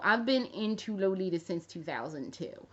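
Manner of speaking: usual speaking voice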